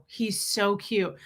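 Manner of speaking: ordinary talk, nothing marked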